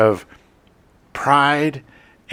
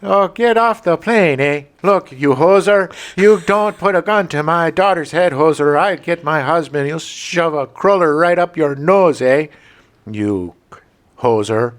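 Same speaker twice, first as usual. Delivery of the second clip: put-on Canadian accent; 5 semitones higher, 3 dB louder than usual. Mocking the First Lady in a Canadian accent. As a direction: ham up the Canadian hoser voice, eh. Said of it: "I can't even do a Canadian accent".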